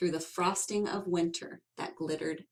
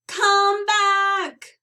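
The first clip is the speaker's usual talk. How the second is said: much higher and louder desperate call; shouted; 12 semitones higher, 9 dB louder than usual. Acted. Sorrel shouting after the bird stealing the cone. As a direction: yell after the flying bird. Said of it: "shouted Sorrel".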